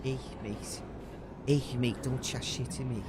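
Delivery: thick-throated Scouse "Ich, mate"; Scouse accent. Shown as a demonstration. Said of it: "how I would do that accent".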